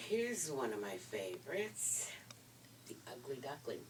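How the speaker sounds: whisper. Real, not acted. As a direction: whisper it like a little secret find. Child choosing a story.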